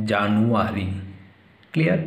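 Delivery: normal talking voice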